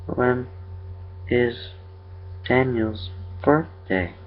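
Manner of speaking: high, soft, halting eerie creature. A narrator's reading. Creature quizzes the father about his son, soft and haltingly eerie.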